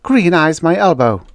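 loud, high indignant outburst; acted angry explosion; exploding. A narrator's reading. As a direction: burst out at the accusation. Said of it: "the redhead exploded".

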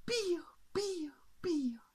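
high, light cheeps; chirping bird. Acted. Bird flies free from the king, high cheeping. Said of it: "aquel pájaro salió volando".

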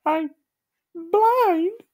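very high cartoon voice, a touch of crying; squeaky mild despair; Gumby's high voice. A narrator's reading. Gumby realises he is blind, high voice. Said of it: "he said".

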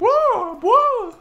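high, loud astonished whoa; amazed whoa. Real, not acted. Friends see something wild in game, shouting whoa in surprise. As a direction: gasp it out in wonder.

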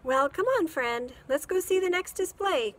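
high, loud cheerful invite; bright friendly call; smiling. Acted. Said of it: "Chrissy said with a smile".

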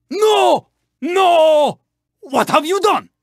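high, drawn-out cries of dismay; dismayed outcry. Acted. Racer just wronged by a rival, high anguished protest.

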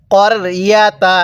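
হাযিহিল করিয়াতা slow, short ক not কা; correct tajweed recitation. Shown as a demonstration. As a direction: recite slowly with correct vowels. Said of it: "তাহলে কিভাবে পড়তে হবে?".

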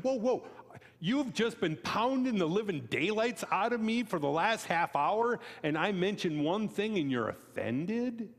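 retold exasperated whoa; incredulous retort. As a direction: throw up your hands in disbelief.

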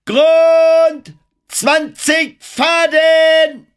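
very high, drawn-out sailor's call; high triumphant cry. A narrator's reading. Lookout at the bow calls the depth, triumphant high cry. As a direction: shout it ringing out, long and high. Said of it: "Die hohe Stimme schallte vom Bug".

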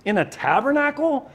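high, loud, incredulous parental scolding; loud mock-parent telling-off. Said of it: "You know, parents do that".

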